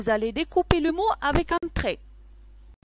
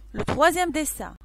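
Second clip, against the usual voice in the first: ordinary talk, nothing marked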